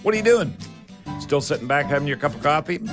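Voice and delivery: usual speaking voice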